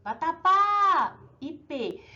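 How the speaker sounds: "vatapá e pê" slow, stressing PÁ and PÊ; "vatapá e pê" with a long PÁ, then a stressed PÊ; stressed last syllable. Shown as a demonstration. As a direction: draw out the final syllables in "vatapá e pê". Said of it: "Última sílaba tônica".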